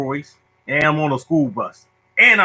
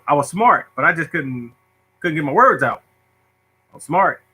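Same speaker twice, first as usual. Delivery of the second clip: ordinary talk, nothing marked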